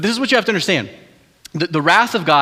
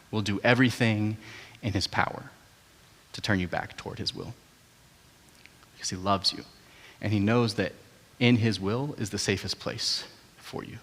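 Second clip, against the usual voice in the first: soft, earnest appeal; 5 semitones lower, 9 dB quieter than usual. Real, not acted. Quiet tender sermon close.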